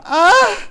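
loud, high put-on moan; exaggerated high "ah" moan; mock moan. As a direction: let out a sudden high moan for show. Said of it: "do you want me to moan".

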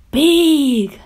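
high, loud little squeal. A narrator's reading. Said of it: "little Gloom squealed".

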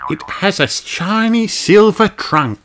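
put-on high, slow explaining kid; explaining child. A narrator's reading. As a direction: patiently tell a friend. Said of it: "explained Kylie".

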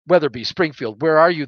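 loud call over the radio; urgent radio call. Acted. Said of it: "the leader of the other two ship asked me".

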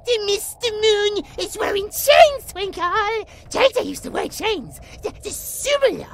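put-on loud, high, gushing kid voice; childlike excitement. J J telling Twinkle about the chains, loud childlike delight. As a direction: marvel loudly like a delighted child.

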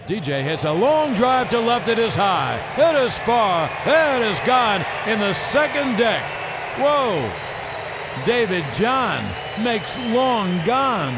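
rising play-by-play to a shout; home run call. Acted. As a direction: build the call to a roaring finish.

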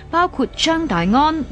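ordinary talk

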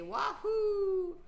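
high loud cheer; excited whoop. Retold or imitated. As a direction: shout it with joy. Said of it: "people say".

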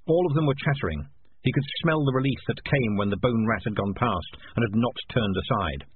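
plain reading voice

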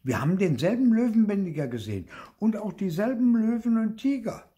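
put-on high, excited child's boast; insistent claim. A narrator's reading. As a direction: assert it eagerly in a high child's voice. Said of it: "behauptete Clara".

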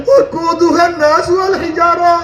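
high, wailing verse, retold as a weeping stone; tearful recitation. Weeping stone tells Musa its fear of hellfire, tearful chanting. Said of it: "আমি কান্নাকাটি করতে আছি".

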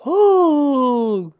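high, loud, delighted "oh"; pleased bear exclamation; Pooh, happy. A narrator's reading. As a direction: draw it out happily, way up high. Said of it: "said Pooh happily".

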